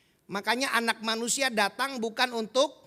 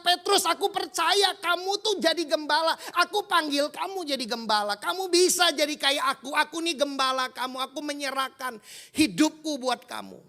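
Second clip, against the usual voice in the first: fervent commission; 5 semitones higher, 6 dB louder than usual. Acted. Jesus commissions Peter as shepherd.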